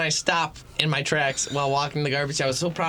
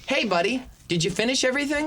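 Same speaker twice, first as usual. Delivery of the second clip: high-pitched imitation of a friend's chummy question; Jeffrey calling attention; 9 semitones higher than usual. Friend exposing the hidden egg roll, mock-friendly high voice. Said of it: "Jeffrey goes".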